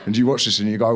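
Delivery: normal talking voice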